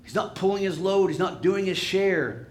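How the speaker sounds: resentful complaint. Acted. Voices disciples resenting sleeping Jesus.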